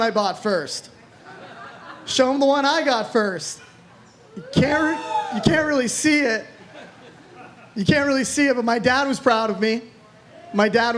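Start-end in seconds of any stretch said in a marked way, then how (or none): 4.3-5.4 laughing squeal. Real, not acted. Cracks laughing at small ring. 5.4-6.5 laughing through the words. Real, not acted. Laughing at tiny ring.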